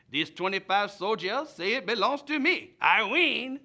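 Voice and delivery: loud, high, triumphant mock-French officer; French commander. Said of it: "the French commander was very polite".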